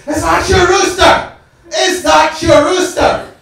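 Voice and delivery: high, loud, slow hammed-up scream; screaming woman. Angry neighbour screaming at the narrator through the door, high and loud. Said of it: "She started screaming at me".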